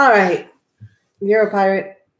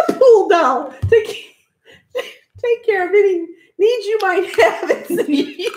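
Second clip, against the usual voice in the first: high voice breaking into laughs; can't keep a straight face; laughing; 7 semitones higher than usual. Real, not acted. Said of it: "She can't say it with a straight face".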